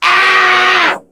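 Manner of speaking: high, drawn-out mock scream; long high wail, copying screaming kids; imitated kids' scream. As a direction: demonstrate the children's screaming. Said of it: "they're like this".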